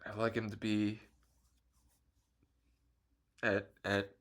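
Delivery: quiet, choked-up voice, words trailing off; holding back real tears while talking about grandpa; getting emotional. Real, not acted. Missing his late grandma, softly and emotionally. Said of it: "I'm sorry for getting emotional".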